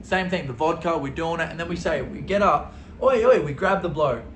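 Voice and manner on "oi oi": loud, high calling out. Retold or imitated. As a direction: shout to grab the bloke's attention. Calling out the waiter.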